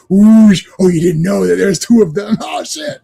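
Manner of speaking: gleeful mock twist with a shout; hammed-up movie reveal. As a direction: play the surprise twin with a laugh. Acting out a surprise twin.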